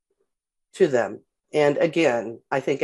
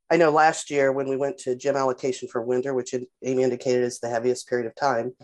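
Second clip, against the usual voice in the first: usual speaking voice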